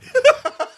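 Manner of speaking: high laugh. Real, not acted. Laugh after punchline.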